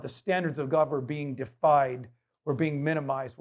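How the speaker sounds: normal talking voice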